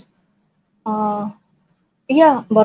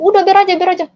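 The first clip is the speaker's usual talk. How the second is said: much higher, agitated voice; imitated heated retort; 8 semitones higher, 3 dB louder, much faster than usual. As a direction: act out a worked-up dismissal. Quarrel over machete, acted out.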